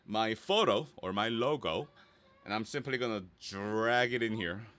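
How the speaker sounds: usual speaking voice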